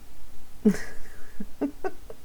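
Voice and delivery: quiet, breath-only giggles; real suppressed laugh. Real, not acted. Reader losing it during the sentimental reading, giggling. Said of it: "Avant que je me remette à rire".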